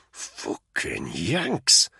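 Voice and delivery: low, very slow hiss of disgust. A narrator's reading. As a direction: spit it out slowly through the teeth. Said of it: "Spud hissed".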